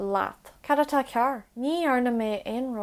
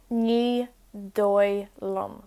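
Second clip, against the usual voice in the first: Ní dóigh liom drawn out slowly; slow it down for learners; slow teaching repeat; much slower than usual. Shown as a demonstration. Teacher repeats Ní dóigh liom slowly for learners.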